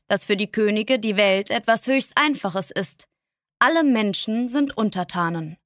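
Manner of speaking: usual speaking voice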